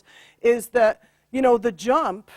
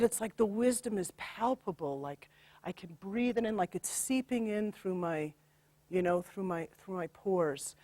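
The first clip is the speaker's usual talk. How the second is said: softer, dreamy and reverent; hushed reverent quote; 3 semitones lower, 12 dB quieter than usual. Retold or imitated. Healer quoting her client's blissful healing, hushed. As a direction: speak it as if breathing it in. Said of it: "She said".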